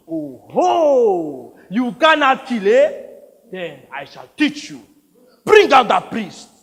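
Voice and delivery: loud, high taunts into a booming order; mock the boy, then command; mocking warlord.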